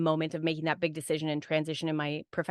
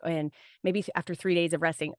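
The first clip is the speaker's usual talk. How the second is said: ordinary delivery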